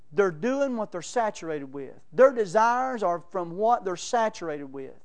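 ordinary talk

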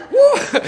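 high loud gleeful woo; joyful whoop. Real, not acted. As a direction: shout for joy like running round the car. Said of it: "running around my car".

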